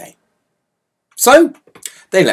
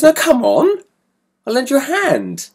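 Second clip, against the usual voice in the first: cheery big brother; 12 semitones higher than usual. Retold or imitated. Brother insisting kindly.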